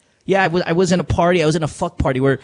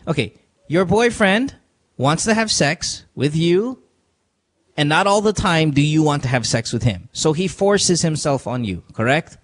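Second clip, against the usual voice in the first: slow explaining. Real, not acted. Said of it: "can I explain it".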